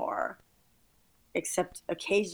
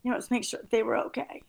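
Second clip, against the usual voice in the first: higher, tearful, voice cracking; crying while pushing on speaking; 5 semitones higher than usual. Real, not acted. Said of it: "I'm sorry, I'm crying".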